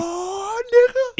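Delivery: high rising hype cry; excited exclamation. Shown as a demonstration. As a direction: hype yourself up at the song. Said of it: "You be like".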